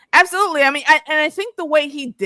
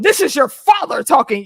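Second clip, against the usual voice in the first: louder, higher mock fatherly announcement; stern father; 5 semitones higher, 5 dB louder than usual. Host imitating Louis addressing his child as father, mock commanding. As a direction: assert parental authority in an exaggerated way. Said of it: "cracked up when Louis said".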